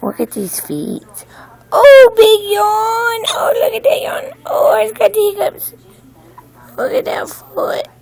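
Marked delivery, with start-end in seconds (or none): 1.7-7.9 high, hushed cooing to a newborn. Real, not acted. Mother cooing over her newborn's yawn and feet, high hushed baby talk.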